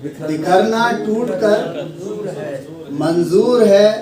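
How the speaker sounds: measured mushaira recital with weighted phrases; Urdu poetry recitation. Acted. Poet opening the gathering with a couplet, recited. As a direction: recite in phrases, pausing for the audience's response.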